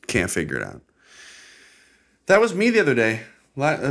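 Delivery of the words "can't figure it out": low, deflated delivery; resigned repeat. Acted. Host gives up on figuring out his anger, resigned. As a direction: drop it down low and give up. Same words, dropped to resignation.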